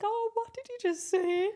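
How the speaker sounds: high, slow, melting surprise; delighted surprise. Retold or imitated. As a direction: gasp it out, touched and amazed. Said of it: "And I was like".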